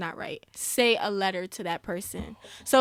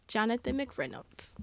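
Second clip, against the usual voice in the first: whisper; 9 dB quieter than usual. Real, not acted. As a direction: say the name with awe, barely voiced. Hushed reverent name.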